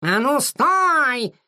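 high, long drawn-out halt cry; all-out shout. A narrator's reading. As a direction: call "stop" after a runaway, stretching it. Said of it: "что есть мочи, закричал Кис-Кис". (протяжный крик вдогонку)

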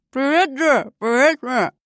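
words swallowed by a mouthful of pizza; mouth full. A narrator's reading. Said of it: "Прозвучало это так".

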